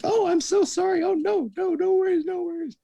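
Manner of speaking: high, breathy falsetto sorry; mock apologetic woman. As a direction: squeak out a flustered apology.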